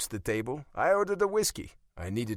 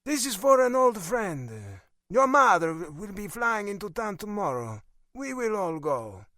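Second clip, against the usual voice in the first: put-on Italian accent; 10 semitones higher, 4 dB louder than usual. A narrator's reading. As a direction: lay it down firmly in an Italian accent. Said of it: "still holding the Italian accent".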